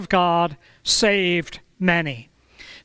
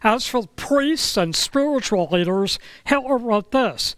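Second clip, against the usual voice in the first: ordinary talk, nothing marked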